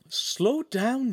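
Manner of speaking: high-pitched interruption; urging calm. A narrator's reading. Friend interrupting the babbling man, high urging. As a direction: cut in high to slow him down.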